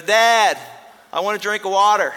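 put-on loud, high child's call down the hall; a small boy calling his dad from bed; little boy calling.

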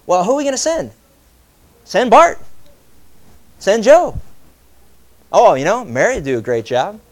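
high, loud eager voice naming others; mock passing the buck. Servant volunteering others, high and eager. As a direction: push the job off on anyone else. Mocks reluctant believer passing off.

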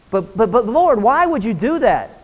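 stammering, high-pitched incredulous question; puzzled protest. Acted. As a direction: swoop up in mock bewilderment, tripping over the first word. Acted puzzled question to the Lord.

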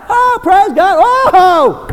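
high, loud joyful cry; loud, high worship outburst; ecstatic praise. Pretend. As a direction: shout it like you can't hold back. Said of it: "They're even gonna lift their hands up".

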